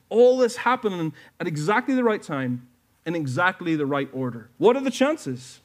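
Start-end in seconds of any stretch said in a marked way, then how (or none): 4.6-5.6 higher, emphatic question, hitting What; exclaimed rhetorical question. Real, not acted. Preacher to his church, an excited rhetorical question. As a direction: throw the question out to the whole room.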